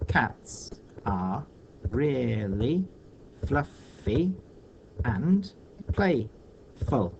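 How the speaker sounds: "Cats are really fluffy and playful" in slow, separated beats; syllable by syllable. Shown as a demonstration. Teacher counting the second line, "Cats are really fluffy and playful" syllable by syllable. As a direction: pause between the pieces of "Cats are really fluffy and playful", lifting them. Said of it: "Let's count these".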